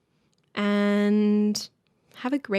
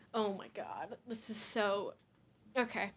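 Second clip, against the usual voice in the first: muttered frustration; 15 dB quieter than usual. Real, not acted. Mutters after camera failure.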